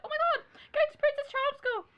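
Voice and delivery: high, loud mock squeal of excitement; excited shout. Acting out the girl picked in the lottery, excited shout. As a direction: squeal it like a prize winner.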